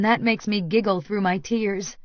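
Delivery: ordinary delivery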